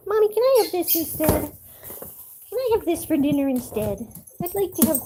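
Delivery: high, pleading puppy voice; voicing the dog. Owner voicing her dog begging for special food, high and pleading. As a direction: beg like the dog asking mommy. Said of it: "She says".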